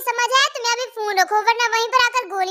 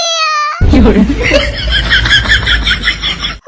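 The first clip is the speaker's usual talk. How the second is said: high, loud bawling wail; hammed-up high wail for mummy; 7 semitones higher, 6 dB louder than usual. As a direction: let out a long high bawl. Girl bursts into wail.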